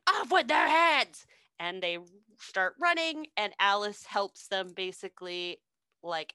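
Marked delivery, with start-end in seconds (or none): 0.1-1.2 higher, louder queen bellow; hammed-up royal yell; mock royal shout. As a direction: snap the order like the Queen of Hearts. Queen shouting in retold story.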